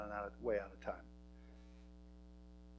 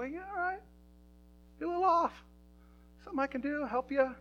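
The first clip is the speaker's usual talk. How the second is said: coaxing his wife out of a mood, pitched way up; gentle pastor quote; 14 semitones higher, 7 dB louder than usual. Retold or imitated. Husband teasing his wife Hope, in a high coaxing voice. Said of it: "I go".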